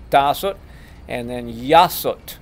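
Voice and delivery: ordinary delivery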